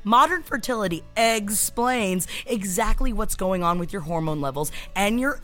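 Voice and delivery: chatty sponsor read over music. Acted. Host reads a fertility test sponsor ad to listeners. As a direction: sell it with jokey asides.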